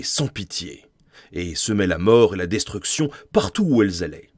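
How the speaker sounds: dramatic, emphatic storyteller with big pitch swings; story narration. Acted. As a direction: read it out like an epic tale, punching key words. Lit à voix haute son histoire. (narration de conte épique, théâtrale et emphatique)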